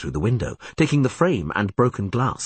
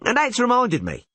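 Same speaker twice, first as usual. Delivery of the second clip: high voice. A narrator's reading. Vökter adds a remark.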